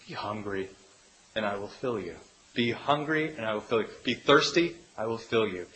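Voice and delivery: soft, tender invitation; gentle voice of God. Acted. Preacher voices God inviting the hungry, soft and tender. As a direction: offer it softly like a promise. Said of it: "All that God says is".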